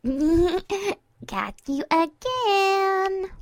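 high, slow, stretched-out victory call; gleeful gloating. Acted. Mare celebrating her snowball hit, gloating. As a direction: draw out the last word in singsong triumph.

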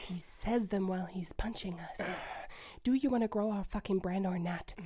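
hushed whisper. Acted. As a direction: whisper it urgently. Hushed argument mid-villain entrance.

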